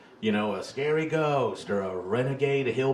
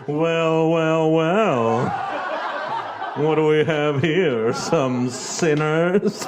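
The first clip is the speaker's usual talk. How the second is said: louder, hammed-up villainous welcome; theatrical youth pastor; 4 semitones higher, 6 dB louder, much slower than usual. Youth pastor greeting kids at the haunted house, theatrical louder ham. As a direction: ham it up like a campy horror host. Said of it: "this dude got to be theatrical".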